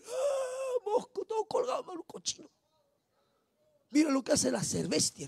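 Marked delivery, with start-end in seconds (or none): 0.0-2.5 put-on high, soft, tearful drunk; drunken crying. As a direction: whimper like a weeping drunk. Drunk man looks over, weeping.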